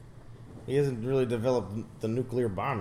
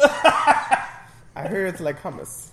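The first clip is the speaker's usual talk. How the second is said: laughing mock line; 6 semitones higher, 10 dB louder, much slower than usual. Mock German joke, laughing.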